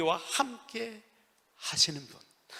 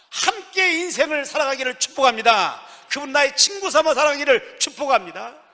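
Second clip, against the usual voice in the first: loud, high pulpit blessing; impassioned preaching; 7 semitones higher, 11 dB louder than usual. Acted. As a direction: pour the blessing out at full voice. Preaching at full force. (높고 큰 소리로 축복을 선포하는 설교)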